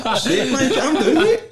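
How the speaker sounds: high, laughing mock telling-off of his younger self; laughing at himself. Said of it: "I was a bit aggressive".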